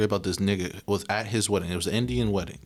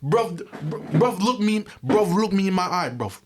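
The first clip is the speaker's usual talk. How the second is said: high, louder London roadman plea; mock London accent; 10 semitones higher, 3 dB louder than usual. The actor confronting someone, in a mock London accent.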